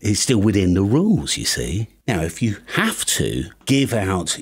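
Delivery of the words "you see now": usual speaking voice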